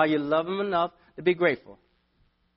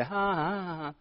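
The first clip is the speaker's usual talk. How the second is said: mock gloating laugh; 3 semitones higher, 5 dB quieter than usual. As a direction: act out gloating at someone stuck. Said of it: "and I wanna say".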